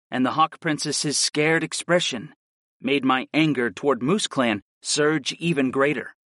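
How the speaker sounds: ordinary delivery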